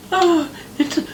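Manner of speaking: high whiny mock sob; mock crying teacher. Mocking a crying teacher on TikTok, high whiny voice. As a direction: whine it out like a weepy teacher.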